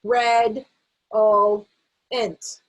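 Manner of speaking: redolent read as red, o, int with pauses; slow group-reading of separate syllables. Shown as a demonstration. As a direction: read each syllable on its own. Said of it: "read them together".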